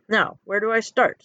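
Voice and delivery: ordinary delivery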